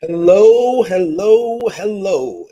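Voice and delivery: booming announcer hellos fading down; ring announcer. Acted. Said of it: "you sound like a ring announcer".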